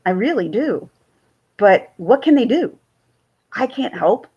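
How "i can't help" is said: normal talking voice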